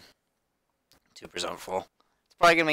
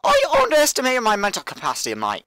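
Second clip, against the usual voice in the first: indignant put-on British accent, pitched up; 5 semitones higher than usual. Player answers the announcer's insult, indignant mock British accent. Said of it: "I should probably stop that actor".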